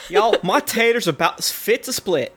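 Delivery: hammed-up Southern accent. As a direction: play up a folksy Southern accent. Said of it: "has a southern accent saying that".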